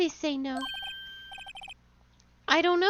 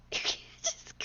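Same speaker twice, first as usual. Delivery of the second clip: laughing; 12 dB quieter than usual. Real, not acted. Laughing at the name Old Bag.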